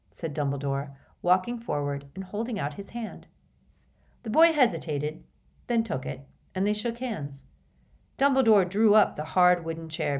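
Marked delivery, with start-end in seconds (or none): none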